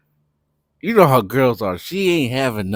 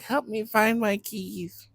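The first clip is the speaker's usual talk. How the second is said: put-on drunk girl voice; 11 semitones higher, 5 dB quieter, slower than usual. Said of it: "She's like".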